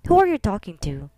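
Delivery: usual speaking voice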